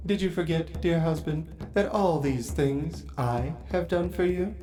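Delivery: soft, reproachful queen; lightly voiced vampire queen. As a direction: remind him what he owes you. Queen reproaches her husband.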